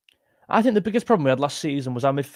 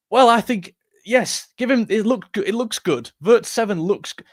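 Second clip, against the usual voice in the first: higher-pitched imitation of fans weighing in; mock eager fan; 6 semitones higher than usual. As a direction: put on a raised, chattering fan voice. Mimicking fans' eager opinions.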